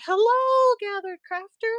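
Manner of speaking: high, loud sing-song host hello; cheery host greeting. Retold or imitated. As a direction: greet viewers like a bubbly live host. The show host greets viewers.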